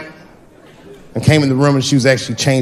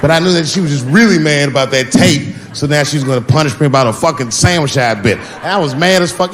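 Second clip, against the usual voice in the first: usual speaking voice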